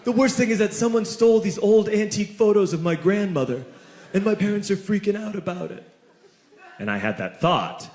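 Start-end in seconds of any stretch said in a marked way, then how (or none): 0.0-5.8 lightly voiced upset teen. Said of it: "And he said".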